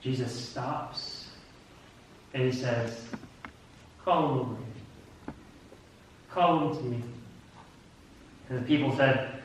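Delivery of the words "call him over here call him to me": low, soft, unhurried command; quiet summons. Acted. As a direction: summon him gently, very softly. Jesus stops and summons him.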